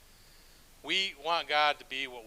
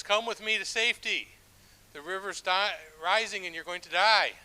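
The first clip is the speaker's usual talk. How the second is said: loud, higher urgent plea from the boat; urgent shout; 5 semitones higher, 10 dB louder than usual. Acted. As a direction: call out to save her. Boat rescuer urging her.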